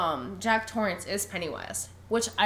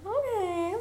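high, drawn-out okay; obliging hotel; 11 semitones higher than usual. Retold or imitated. Podcaster voices the hotel granting Jack's wish, high and drawn out. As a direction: sing out a high, comic agreement. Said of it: "And the hotel's like".

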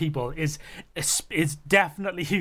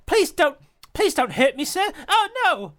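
much higher and louder, exaggerated prim British accent; hammed-up frightened posh English girl, pitched up; mock posh British accent; 12 semitones higher, 6 dB louder than usual. Mocking Natalie Portman's film role in a posh British accent. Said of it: "The kind of accent".

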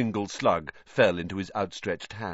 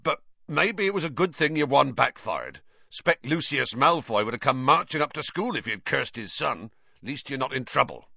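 higher, gruff giant reasoning; rough-and-ready consolation, pitched up; hearty Hagrid; 7 semitones higher than usual. Acted. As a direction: reassure him heartily. Voices Hagrid.

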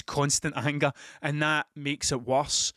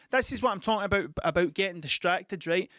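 ordinary talk

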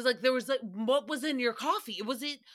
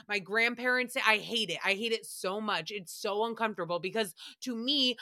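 ordinary talk

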